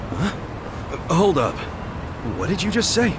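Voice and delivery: high, startled demand to repeat; shocked. Acted. Ex-con stops the daughter, shocked and high-pitched. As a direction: stop her cold, stunned.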